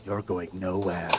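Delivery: slow, low menacing hag; quiet, drawn-out threat from the hag. Acted. Hag warns the party as she appears, slow and menacing. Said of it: "She says".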